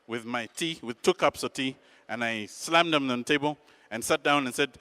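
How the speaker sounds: ordinary delivery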